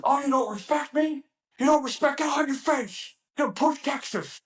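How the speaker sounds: high-pitched mock tirade; imitated screaming instructor. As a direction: holler like an outraged drill instructor. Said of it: "screamed at you for your answer".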